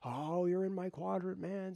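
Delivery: high, casual mock-dude voice; playfully voicing his opponent. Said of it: "He's entered my quadrant".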